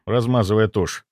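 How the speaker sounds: ordinary delivery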